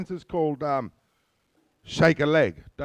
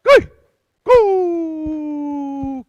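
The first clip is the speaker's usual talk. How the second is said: shout; 13 semitones higher, 11 dB louder than usual. Real, not acted. Dance leader calling out.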